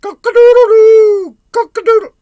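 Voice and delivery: piercing cock-a-doodle-doo; cockerel crowing. Acted. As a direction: crow at full blast like a rooster. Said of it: "What noise do cockerels make?".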